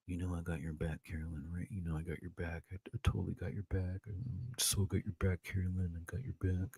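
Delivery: lightly voiced Rob, whispering false promises; mock Rob. Mimicking Rob reassuring Carolyn, hushed and fake. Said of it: "it's Rob".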